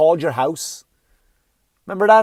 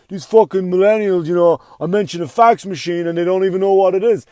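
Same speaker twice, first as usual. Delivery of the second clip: put-on grumpy old comic; 3 semitones higher, 6 dB louder than usual. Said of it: "older comics get mad".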